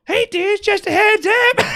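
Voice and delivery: high squeaky cartoon rabbit voice; Ramblin' Rabbit. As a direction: shout it as a tiny cartoon rabbit. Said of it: "imagine Ramblin' Rabbit saying exactly that".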